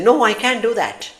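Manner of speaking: high, far-off machine reply; robotic assistant voice. Retold or imitated. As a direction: play the phone assistant refusing you, high and flat-out. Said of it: "sometimes it says".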